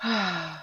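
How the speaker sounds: long deep exaggerated sigh; dramatic sigh. Shown as a demonstration. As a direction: heave an even bigger sigh. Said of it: "I'm gonna do it again 'cause it's big".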